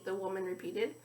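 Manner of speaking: usual speaking voice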